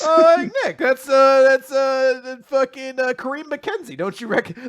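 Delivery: loud, high-pitched fan urgency; mock insistence. Naming obscure Giants players to Nick, mock-insistent. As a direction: blurt names as if everyone should know.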